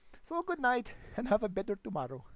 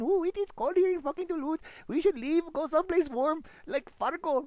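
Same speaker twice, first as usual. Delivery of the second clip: shivering locals; 8 semitones higher, 5 dB louder than usual. Retold or imitated.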